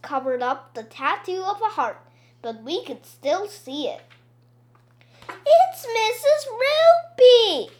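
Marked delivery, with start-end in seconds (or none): 5.3-7.7 high, loud, drawn-out revelation; shouting. A narrator's reading. Boy exposing Missus Roopy to the class, shouting. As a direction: yell out the big discovery. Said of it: "Michael shouted".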